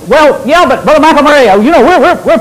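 high, loud whiny protest, half laughing; mocking objector. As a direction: mimic a flustered listener, high pitch. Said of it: "You say".

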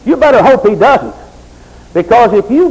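sermon at full pitch; fiery preaching. Real, not acted. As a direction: preach it hard to the congregation.

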